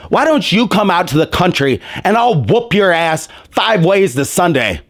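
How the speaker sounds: ordinary delivery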